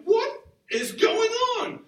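high, slow, loud cry of shock; mock shock. Pastor seeing the crew in jeans, loud high mock shock. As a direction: stretch it out in comic horror. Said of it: "And I'm like".

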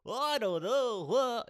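high, drawn-out wobbly attempt; badly faked character voice. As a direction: try a silly voice, slowly, and miss. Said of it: "You should do the voice for the movie".

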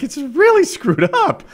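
shocked. Retold or imitated. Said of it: "they're like".

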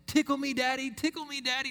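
put-on high, loud little-girl squeal; imitating his daughter calling out to be tickled; imitating child's yell. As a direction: squeal it like a delighted toddler begging. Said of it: "when she's yelling".

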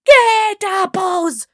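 long, high, drawn-out scream. Acted. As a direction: scream the order, stretching each word. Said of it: "screamed stepmother".

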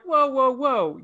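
talking down a reckless side character, scolding; mock scolding whoa. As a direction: hold it right there, buddy.

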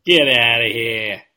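put-on loud, slow New York accent. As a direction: bark it like a New York cabbie. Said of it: "our terrible New York accents".

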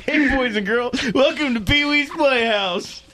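high, slow mock kiddie-show greeting; sing-song children's host parody; kids' TV host. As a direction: ham it up like Pee-wee's Playhouse. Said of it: "intro for Captain Kangaroo".

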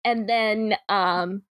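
ordinary delivery

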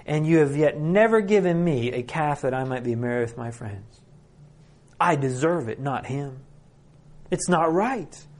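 usual speaking voice

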